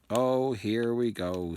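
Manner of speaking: slow, bitter sigh of a line. A narrator's reading. Nick watches the headless hunt arrive uninvited, bitter and slow. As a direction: drag it out, resigned and sour. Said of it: "said Nick bitterly".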